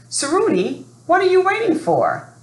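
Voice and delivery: put-on a mother hurrying her boy along; urging mother. Mother Yayo urging her son.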